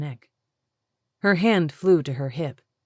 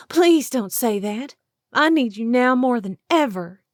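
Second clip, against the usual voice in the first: high tearful pleading; 8 semitones higher, 7 dB louder than usual. Acted. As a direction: beg her not to leave. Julie pleading, tears come.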